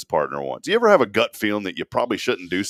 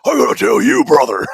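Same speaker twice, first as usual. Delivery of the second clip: Hulk Hogan impression; 8 dB louder than usual. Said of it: "He goes".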